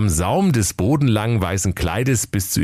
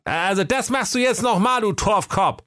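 very high, exasperated jab; mocking Wilhelm. Wilhelm tells Jakob to try again, high and exasperated. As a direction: needle the blockhead brother.

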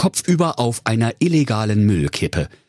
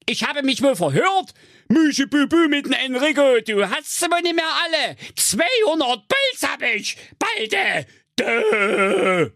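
much higher furious witch voice ending in a yell; angry witch. Acted. The witch furious at Rapunzel, high angry shout. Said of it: "aber zornig und rief".

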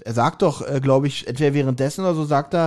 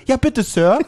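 put-on high, eager boy's voice, a little louder; eager Bob; 6 semitones higher, 3 dB louder, slower than usual. Friend mimics eager Bob with a high, eager voice. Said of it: "wie Bob sagt".

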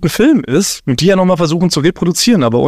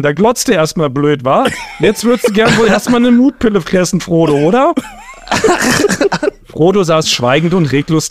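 theatrical parody audiobook in Berlin dialect; Berlin-dialect story reading; 3 semitones higher than usual. Acted. Host reads a rewritten Lord of the Rings parody, Berlin-dialect story reading. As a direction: read it out in broad Berlin dialect, drawing out phrases.

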